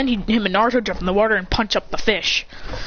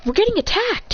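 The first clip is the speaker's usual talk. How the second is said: high, urgent alarm; alarmed warning; 12 semitones higher than usual. Acted. Teammate warning the others of the attack, high and alarmed. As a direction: blurt it out like an alarm.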